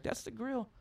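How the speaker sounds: put-on high, matter-of-fact girlfriend voice. Girlfriend explaining the hibachi grill to him, in a high voice. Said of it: "She's like".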